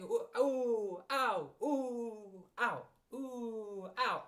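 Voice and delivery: high, drawn-out ooh and ow; bounced oohs and ows. Acted. Piglet jolted all the way to Kanga's house, crying out. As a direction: cry out at each jolt, up and down. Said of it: "he was saying".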